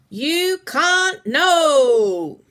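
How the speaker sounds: high, slow, loud squawking parrot; a shrill-free squawk of a posh bird; loud British parrot. A narrator's reading. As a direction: stretch it out like a proud parrot in a British accent. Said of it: "said the parrot loudly in a British accent".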